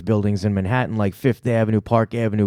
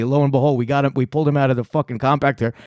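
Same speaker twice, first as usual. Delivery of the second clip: ordinary talk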